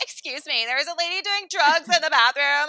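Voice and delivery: high, rising tattling voice; tattletale. Retold or imitated. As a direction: act out telling on someone to the manager. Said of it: "the big tattletale that I am".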